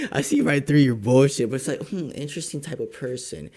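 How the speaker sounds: ordinary talk, nothing marked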